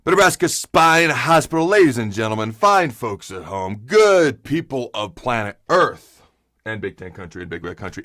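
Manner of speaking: booming, slowed show-host welcome; show intro. Acted. Host welcoming fans to his show in a big announcer voice. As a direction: announce it like opening a big broadcast.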